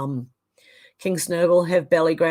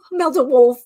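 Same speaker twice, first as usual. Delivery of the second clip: higher, louder words through laughter; real laughter; 6 semitones higher, 7 dB louder than usual. Real, not acted. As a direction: laugh while repeating the joke. Laughs at a fart joke.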